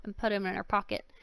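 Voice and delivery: usual speaking voice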